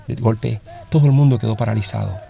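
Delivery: usual speaking voice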